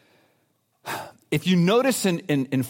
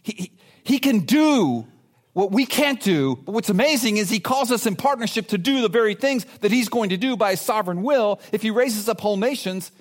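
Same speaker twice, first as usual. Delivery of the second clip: ordinary talk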